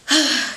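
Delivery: acted, audible sigh of frustration; frustrated sigh. Acts out venting to God.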